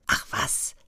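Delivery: breathy whispered dismissal to himself; whispered aside. Acted. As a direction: whisper it as a sneaky thought. Boy brushing off his doubts.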